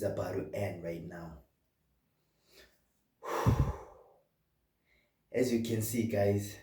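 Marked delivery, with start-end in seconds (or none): 3.2-3.8 loud exhaled sigh; heavy sigh. Acted. Boss to underperforming artist in a meeting, heavy sigh. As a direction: let out a big, audible breath.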